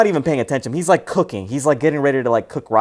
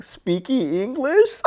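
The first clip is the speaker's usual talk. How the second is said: mock question; 12 semitones higher, slower than usual.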